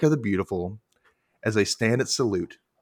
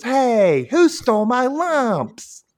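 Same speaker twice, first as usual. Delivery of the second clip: put-on high, slow outraged boy; mock angry kid; 13 semitones higher, 4 dB louder, much slower than usual. Little brother mad about his cereal, high. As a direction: whine it like a cartoon brat. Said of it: "he gets mad and says".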